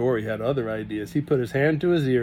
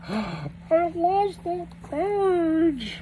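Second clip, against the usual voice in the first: tearful; much slower than usual. Real, not acted. Strange voice, far, crying.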